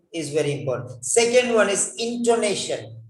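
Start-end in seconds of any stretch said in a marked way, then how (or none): none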